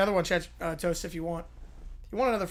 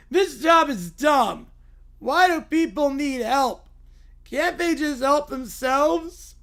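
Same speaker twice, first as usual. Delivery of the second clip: slow, drawn-out grumbling whine; whiny Little Bear; 6 semitones higher, 7 dB louder, much slower than usual. Acted. Little bear sick of helping people, slow whine. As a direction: drag each complaint out like a sulky cub.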